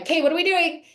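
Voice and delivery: high and eager, raring to go; excited. Retold or imitated. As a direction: jump up ready for the day. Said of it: "I'm like".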